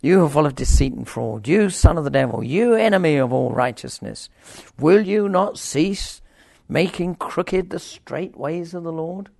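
loud, forceful denunciation, slowing at the end; strong rebuke. Acted. Paul confronting Elymas before the proconsul, loud and forceful. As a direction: hurl it at him like a prophet. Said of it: "speaking strongly and powerfully".

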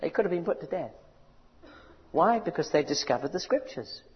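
ordinary delivery

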